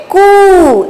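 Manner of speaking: कू with a long, held "oo"; syllable demo. Shown as a demonstration. Teacher reading out the syllable to children, कू drawn out. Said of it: "इसको क्या पढ़ेंगे हम".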